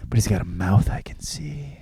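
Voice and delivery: breathy mock-swooning whisper; hushed dreamy gush. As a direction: whisper it like a smitten heroine. Heroine swooning over her captor.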